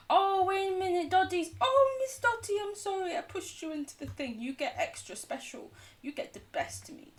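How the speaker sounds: high sweet pet talk. Real, not acted. Apologising to her Minecraft dog.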